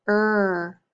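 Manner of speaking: ER held as one long sound; isolated letter sound. Shown as a demonstration. Teacher drilling sound cards, ER held alone. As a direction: draw out the end of butter, ER. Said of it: "do our letter sound cards now".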